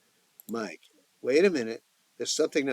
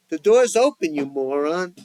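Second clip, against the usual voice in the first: much higher and louder insult; yelling; 5 semitones higher, 6 dB louder than usual. A narrator's reading. Joey yells at whoever rang the bell, loud. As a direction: bark it at the door.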